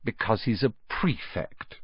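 soft proud motherly tone; fondly. Acted. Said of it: "said their mother fondly".

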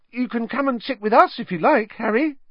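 very high, loud, starstruck calls; a thrilled kid calling out to his hero; eager Colin. A narrator's reading. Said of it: "Colin looked eagerly".

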